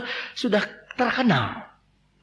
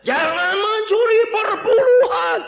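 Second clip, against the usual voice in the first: very high, loud, slow shout; shouted command; 5 dB louder, slower than usual. Acted. Preacher shouts at those who skip tithes.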